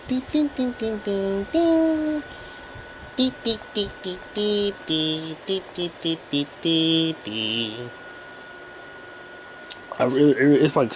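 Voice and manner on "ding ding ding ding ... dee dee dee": light, high sung piano plinks; imitated piano notes. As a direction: tap out a little tune with your voice, note by note. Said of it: "Little light piano touches".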